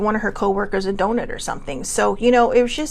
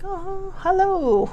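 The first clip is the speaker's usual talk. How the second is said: playful critter hello, pitched up, slow and hushed; cute squirrel voice; 9 semitones higher, much slower than usual. Pretend. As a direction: voice a cute squirrel, higher and drawn out. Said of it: "They're just all like".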